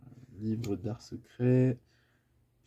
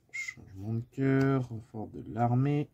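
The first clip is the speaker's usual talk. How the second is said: ordinary talk, nothing marked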